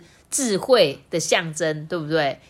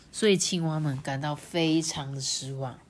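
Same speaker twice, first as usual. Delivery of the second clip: usual speaking voice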